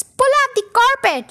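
high, commanding storybook call; read-aloud shouted order; mild shout. A narrator's reading. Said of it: "shouted Muggle Wump".

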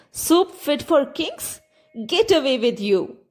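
put-on high, slow, scoffing old woman. A narrator's reading. Old woman scoffs at the soup claim, high and slow. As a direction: scoff at the idea.

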